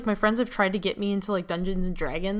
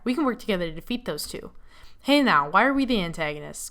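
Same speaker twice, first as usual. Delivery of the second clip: usual speaking voice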